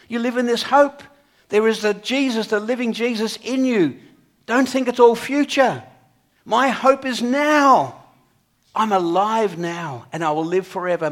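loud, high proclamation, slowing on my hope is now; full-force preaching. Acted. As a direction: proclaim the living hope with conviction.

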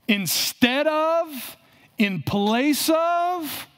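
very slow, each phrase laid out deliberately; teaching pace, dwelling on the meaning of anti; slow emphatic definition. Shown as a demonstration. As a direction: spell out "instead of" and "in place of" slowly, leaning on each. Defining the Greek preposition.

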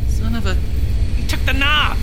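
loud, high, slow outburst; frustrated shout. Acted. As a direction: blurt it out in frustration. Frustrated discovery in car.

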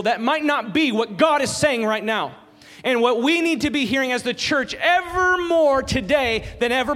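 rising sermon intensity; preaching. Acted. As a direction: press the point home to the congregation. Preaching at full force.

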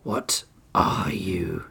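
low, slow whispered growl; the bound crocodile menacing his captor in a low whisper. Acted. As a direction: breathe it out as a menacing question. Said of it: "Oryx growled".

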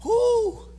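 high-pitched "whoo"; preacher's whoop. Real, not acted. Pastor feeling a word from the Lord, high whoop.

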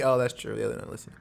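normal talking voice

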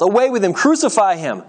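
high mob demand, not full volume; yelling. A narrator's reading. Crowd demands execution from Pilate, restrained yelling. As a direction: cry out like an insistent crowd. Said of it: "they yelled".

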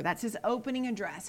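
ordinary talk

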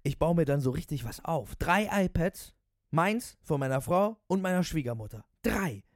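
high, loud bragging enthusiast; raving cabbie. Acted. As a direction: boast like an over-excited taxi driver. Voices the raving taxi driver.

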